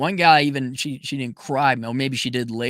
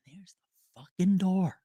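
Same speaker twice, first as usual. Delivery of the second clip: hushed, whispered dismissal; whispered ultimatum; 4 semitones higher, 5 dB quieter than usual. Acted. Man showing a crying partner the way out, whispered. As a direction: whisper it as a final warning.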